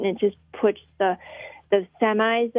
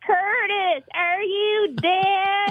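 high, long drawn-out Southern yell; 8 semitones higher, much slower than usual. Acted. Guest quotes the wife's line to Curtis from the movie, Southern yell. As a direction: holler it slowly, way up high. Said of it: "she, she yells out".

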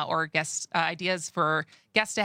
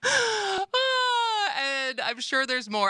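laughing; 11 semitones higher, much slower than usual. Real, not acted. Laughing into the next line.

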